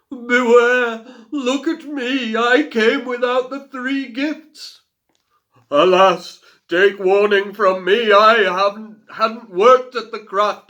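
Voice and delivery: loud, high, mournful warning; wailing ghosts. A narrator's reading. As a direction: moan it from beyond the grave. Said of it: "she heard them wailing".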